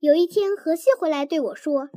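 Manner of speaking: ordinary delivery, nothing marked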